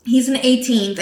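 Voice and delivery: ordinary talk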